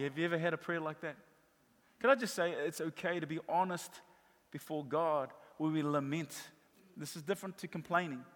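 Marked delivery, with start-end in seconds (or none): none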